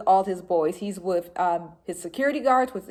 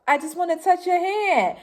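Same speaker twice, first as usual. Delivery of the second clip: higher-pitched retelling of a star's request; a lightly imitated rapper, pitched up; 10 semitones higher than usual. Rapper asking a shocked fan, lightly imitated. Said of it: "I remember the sound of his voice".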